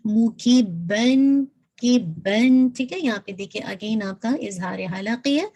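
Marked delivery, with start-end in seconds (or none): 0.1-1.4 مو کی بن very slow, کی and مو crisp and sharp; sharp short vowels. Shown as a demonstration. Teacher modelling مو کی بن, slow recitation. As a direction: recite مو کی بن slowly with clipped vowels. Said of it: "کاف کی کسرہ شارپ رکھیے گا".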